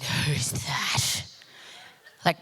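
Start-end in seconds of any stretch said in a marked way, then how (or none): 0.0-1.2 low, intent whisper. Retold or imitated. Asking her friend about a man at the bar, whispered. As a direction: whisper it like a secret question to a friend.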